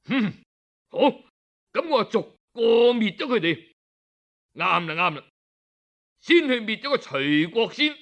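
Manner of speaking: a tyrant brushing off caution; scheming king. Acted. The king answers Zheng Dan with a scornful, quick plan.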